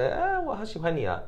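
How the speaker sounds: 模仿粉丝撒娇; gushing fan. Retold or imitated. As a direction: tease a starstruck fan.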